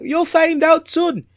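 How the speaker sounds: put-on very high teasing African accent. Host keeping the dish secret, high African accent. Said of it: "And he's like".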